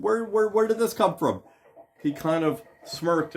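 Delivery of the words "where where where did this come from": retold surprised question. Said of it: "I said".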